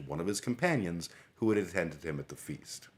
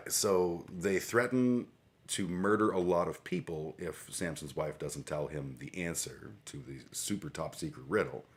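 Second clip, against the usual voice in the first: usual speaking voice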